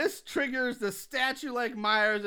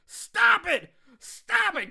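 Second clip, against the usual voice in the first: much higher, loud screamed command; mock scream; 11 semitones higher, 7 dB louder, much slower than usual. Podcaster retelling Loomis screaming at Lori, mock scream. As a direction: scream it at her. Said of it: "Loomis starts screaming".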